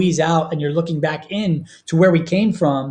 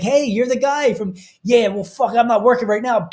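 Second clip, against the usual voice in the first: higher, half-playful retelling of a brawl line; movie bartender quote; 6 semitones higher than usual. Retold or imitated. Retelling a Forgetting Sarah Marshall beach scene, higher and playful. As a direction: toss it off like the movie guy mid-fight. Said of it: "he's like".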